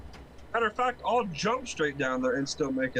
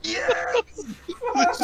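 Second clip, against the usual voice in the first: very high shrieking yes through laughter; cackling triumphant yes; gleeful laughing yes; 4 dB louder than usual. Real, not acted. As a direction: crow in delight at the crash. Gloating as friend crashes.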